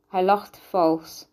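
usual speaking voice

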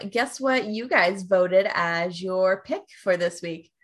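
ordinary talk

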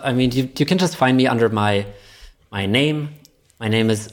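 normal talking voice

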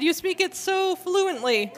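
high, slow, drawn-out retold compliment; imitated excited praise. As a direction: gush admiringly, stretching "so fluently". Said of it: "very excitedly".

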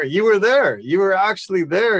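upbeat and emphatic; enthusiastic. Real, not acted. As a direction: cheer them on warmly. Host cheering guest on.

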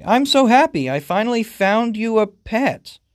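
high-pitched, gushing delight; happy owner. Acted. Owner tells new pet he found it, happily. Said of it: "your owner says happily".

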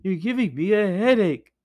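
high, whining ghost skull; whiny skull voice. Acted. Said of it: "said the skull".

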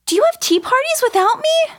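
high indignant. Acted. Childish accusation.

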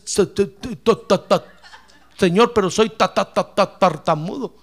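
comic stammer on repeated syllables; exaggerated stuttering before the word lands; imitated stutter. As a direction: act out getting stuck on each syllable. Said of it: "Yo era tartamudo, hermano, tartamudeaba un poco".

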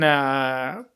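ordinary delivery

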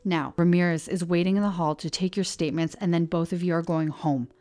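loud, stern fatherly order; angry command. Acted. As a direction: slam down the final word. Sheriff slams desk, lays down law.

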